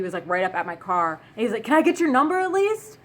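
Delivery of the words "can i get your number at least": high, loud mock plea climbing upward; imitated yell. Man yelling after a woman at her car, mock desperate yell. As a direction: yell it as a last-ditch request, pitch way up. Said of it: "he yells".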